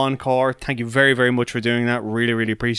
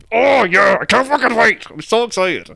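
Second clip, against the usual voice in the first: excited outburst; 8 semitones higher than usual. Retold or imitated. Fan reacting to the film trailer, wildly excited. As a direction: blurt it out overexcited.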